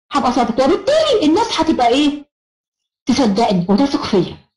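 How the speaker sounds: ordinary talk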